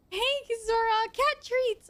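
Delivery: high, bright pet-calling voice; calling the cat. Real, not acted. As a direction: sing out to the cat that treats are here.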